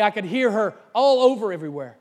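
normal talking voice

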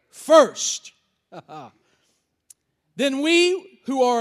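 deliberate, weighty pulpit reading with pauses; preacher landing hard on key words; emphatic scripture reading. Acted. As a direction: proclaim the verse phrase by phrase. Reads the verse aloud to the congregation.

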